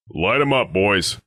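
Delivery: high-pitched rallying command to fire; calling an order. Acted. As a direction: call out the attack order to your squad. Guard orders the squad to fire.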